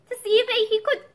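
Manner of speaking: put-on high small-boy voice with a slight tremor; nervous child's high-pitched explanation; little tremor. A narrator's reading. Boy explaining himself to his father, slightly tremulous. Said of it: "with a little tremor in his voice".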